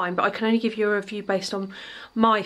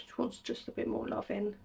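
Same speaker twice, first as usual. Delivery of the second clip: ordinary talk, nothing marked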